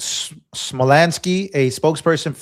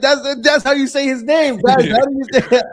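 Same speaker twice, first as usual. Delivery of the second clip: laughing; 9 semitones higher than usual. Real, not acted. Defending the joke pronunciation, laughing.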